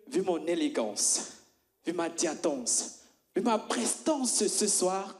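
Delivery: slow, pompous boasting; mock grandeur. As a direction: savour each word like a showman. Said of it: "blagues charismatiques".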